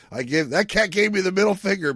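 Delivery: high, loud mock outrage; hammed-up outrage. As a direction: play up the joke indignantly. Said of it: "I was like".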